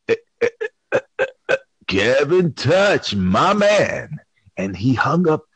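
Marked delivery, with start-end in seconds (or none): 0.1-4.2 glitching stutter. Retold or imitated. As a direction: drag it out like a broken voice. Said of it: "when he said".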